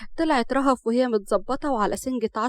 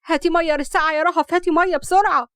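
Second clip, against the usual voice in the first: high, urgent mother's order; hurried, urgent; 5 semitones higher, 5 dB louder than usual. A narrator's reading. Mother sending Rahaf for water after the spill, urgent. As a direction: send her off urgently, pitched up. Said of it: "نجاة قالت بسرعة".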